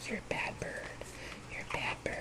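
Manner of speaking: soft whispered scolding; whispering. Real, not acted. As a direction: whisper it to the bird. Scolding pet bird fondly.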